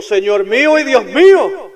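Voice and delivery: loud, high, slow exclamation; cried out. Acted. Thomas touching the wounds, crying out loud. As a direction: shout it in awe. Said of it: "Él gritó".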